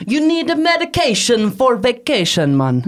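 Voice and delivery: a playful Bahamian impression, laughing through it; quoting a friend in a Bahamian lilt; Bahamian accent. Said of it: "Bahamian accent".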